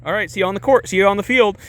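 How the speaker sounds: high, light sing-out sign-off; playful. Retold or imitated. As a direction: imitate a coach turning suddenly chummy. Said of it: "then he's, like, playful".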